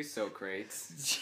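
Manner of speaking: teasing "so-crates" for Socrates; misread Socrates. Shown as a demonstration. Teasing Jason with the wrong reading, mispronounced. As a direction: say Socrates as "so-crates", two syllables. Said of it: "how I read Socrates".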